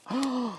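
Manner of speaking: a sudden high gasp of discovery; startled intake of breath; sharp gasp. Retold or imitated. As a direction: catch your breath as it dawns on you. Sudden realisation in the boat.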